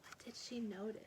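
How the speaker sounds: soft unvoiced whisper; whispered question. A narrator's reading. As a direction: whisper the mystery to the listener. Hushed question to listeners.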